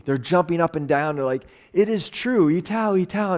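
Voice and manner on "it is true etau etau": mild mock scream, higher pitch; retold excited screaming. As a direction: imitate a cheering village without really shouting. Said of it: "and they're screaming".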